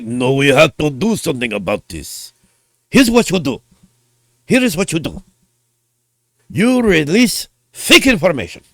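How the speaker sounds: hammed-up Japanese executive accent; bad Japanese accent. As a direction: play Nintendo plotting, in a clumsy Japanese accent. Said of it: "not very good at Japanese accents".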